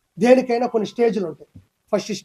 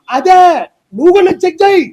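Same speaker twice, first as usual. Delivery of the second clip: loud challenge; 7 semitones higher, 8 dB louder than usual. Real, not acted. Challenging the reporter back.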